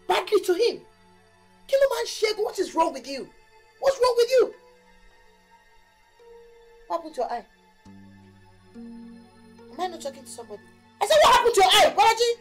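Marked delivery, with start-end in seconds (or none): 1.7-2.4 high, loud Yoruba demand; angry Yoruba shout, higher and louder. Acted. Mother shouting at son. 3.8-4.5 higher and louder, yelled; mother yelling the question again, higher and louder; angry shout. Acted. Repeat shouted. 11.0-12.3 loud, high, furious yell; mother shouting at her son, higher and louder. Acted. Mother demanding answer.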